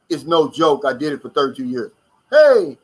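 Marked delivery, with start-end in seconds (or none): 2.3-2.8 high, loud hey to a friend walking up; loud cheerful greeting. Real, not acted. Host greets his tennis partner walking into the stream, loud and happy. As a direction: call out happily to your buddy.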